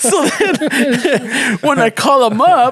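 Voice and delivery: slow words broken by laughter; can't stop laughing mid-story; laughing while talking. Real, not acted. Teller recalling the phone call, laughing through the words. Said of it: "laughing through the retelling".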